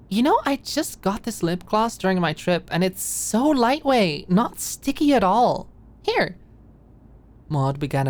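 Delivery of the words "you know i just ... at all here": high, bubbly and slowed gushing; excited girly chatter. Acted. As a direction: gush about new lip gloss. Maud excited about lip gloss.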